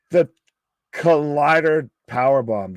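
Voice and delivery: ordinary talk, nothing marked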